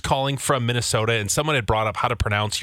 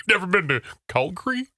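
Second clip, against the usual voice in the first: higher, puzzled echo; imitating a relative querying the word; baffled family member; 6 semitones higher than usual. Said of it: "when I said it to my Canadian family".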